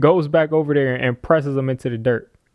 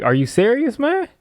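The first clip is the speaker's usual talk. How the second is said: mock disbelief; 10 semitones higher than usual. Voicing disbelief at the blitz call.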